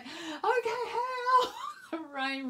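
high laughing exclamation. Retold or imitated. Walking into the rain-soaked room, laughing shocked. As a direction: squeal it out through laughter. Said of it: "and it was like".

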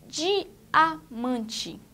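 "Diamante" in beats, di, a, mante; syllables separated. Shown as a demonstration. Teacher asking for the first syllable, syllables separated. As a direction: split "Diamante" so the first DI stands alone. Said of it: "Qual é a primeira sílaba?".

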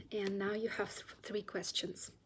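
soft whispered phrase. Real, not acted. Teacher tells the class about the questions, whisper. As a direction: drop to a whisper.

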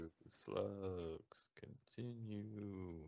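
very slow, hushed whisper to oneself; absent muttering. Real, not acted. Co-host typing a Google search about slugs, slow whispered muttering. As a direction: murmur the words slowly while typing them.

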